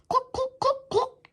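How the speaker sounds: high clucking chicken. A narrator's reading. Hypnotized principal acting like a chicken, high clucks. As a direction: cluck like a hen. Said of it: "he cried".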